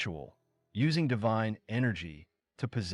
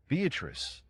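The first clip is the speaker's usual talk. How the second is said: ordinary delivery, nothing marked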